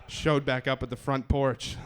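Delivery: ordinary delivery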